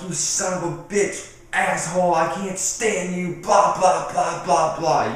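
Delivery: high, hammed-up cursing rant; mock outburst running into punchy babble; acted road rage. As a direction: rail at a driver who cut you off. Acts out a road-rage cursing fit.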